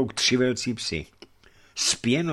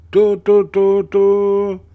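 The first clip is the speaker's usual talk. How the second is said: loud, high horn blasts, tu tu; horn call imitation; 12 semitones higher, 12 dB louder, much slower than usual. A narrator's reading. Boy blowing his horn to raise the alarm, loud and high.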